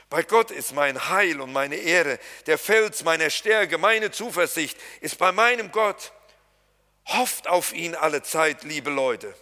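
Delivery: slow, loud psalm recitation; solemn scripture reading. Acted. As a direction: proclaim each phrase of the verse. Reads psalm verse aloud.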